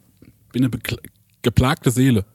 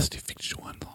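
unvoiced whispered insult; 17 dB quieter than usual. Joking about whispering insults to Alexa, whispered. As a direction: hiss it in a whisper.

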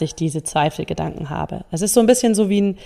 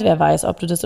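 ordinary talk

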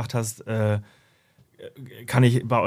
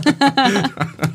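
high burst of laughter; loud laugh; 12 semitones higher, 6 dB louder than usual. Real, not acted. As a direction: break out laughing.

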